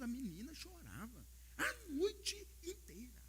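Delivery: put-on slow, breathy whisper about the crying baby; hushed whisper. Pastor to the church, hushed whisper about the crying baby. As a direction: whisper it slowly as if not to wake anyone.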